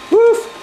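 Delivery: one high, short pretend woof; dog bark imitation. Person playing the dog to tease the cat, a high bark. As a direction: give a single high-pitched bark.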